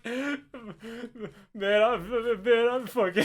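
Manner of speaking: faltering mock-frightened stammer; very scared, imitated. Jerry told to wear the bag, imitated fright. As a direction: stumble over the words in imitated fear. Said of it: "he's very scared right now".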